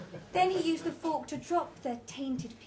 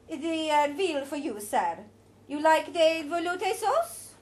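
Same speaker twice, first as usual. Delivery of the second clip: higher, louder, slow, a touch put on as a waiter; 5 semitones higher, 8 dB louder, slower than usual. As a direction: play the fussy waiter presenting the veal. Waiter serving the meal.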